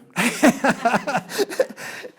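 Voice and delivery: quick high laugh. Real, not acted. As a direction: laughs at the memory. Duchovny story.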